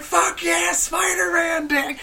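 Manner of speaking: high, excited shout; excited yell. Retold or imitated. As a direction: cheer it out high like at a show. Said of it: "And I'm like".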